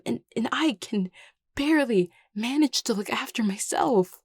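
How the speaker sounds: high, distressed protest; overwhelmed. Acted. New crown doubting their right to lead, overwhelmed.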